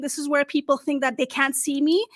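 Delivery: ordinary delivery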